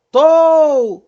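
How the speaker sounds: "tou" bem forte e agudo, longo, deslizando pra baixo; O forte, U sumindo. Shown as a demonstration. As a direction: sustente o O de "tou" até o U sumir. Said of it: "o O forte e o U quase sumindo". ("tou" esticado e decrescente)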